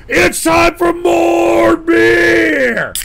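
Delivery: stretched beer-time yell; shouting for beer. Real, not acted. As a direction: bellow it like a rallying call. Announcing beer time to chat.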